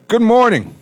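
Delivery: high, loud good morning; calling out a greeting. Real, not acted. Host greeting the radio audience, calling out. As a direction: sing out the greeting big and high. Hosts call out show greeting.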